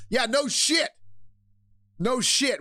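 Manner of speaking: high, emphatic "no shit" reaction; exasperated agreement. Real, not acted. Host reacting to the star's tiring of new pronouns, exasperated. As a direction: raise the pitch sharply, as if it's blindingly obvious.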